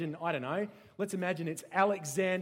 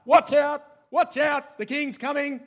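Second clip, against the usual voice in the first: high, urgent shouting; herald clearing the way; 9 semitones higher, 12 dB louder than usual. Acted. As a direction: yell at the crowd to move aside. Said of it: "they're clearing the way".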